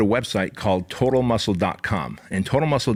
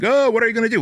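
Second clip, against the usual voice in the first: high, sing-song jeer; mocking taunt; 12 semitones higher, 3 dB louder, much faster than usual. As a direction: egg him on like a heckling crowd. Crowd taunting the bouncer.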